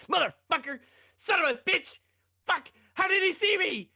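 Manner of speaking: full rage-quit yelling; raging gamer. Retold or imitated. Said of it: "getting really mad".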